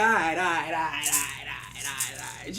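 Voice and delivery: low repeated muttering, fading away; grudging giving in. Acted. Shango relents to the begging.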